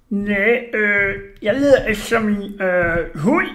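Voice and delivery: hesitant excuse, jumping high on the last word; nervous. A narrator's reading. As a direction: stall with fillers, then blurt it high. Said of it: "gik nervøst et skridt baglæns".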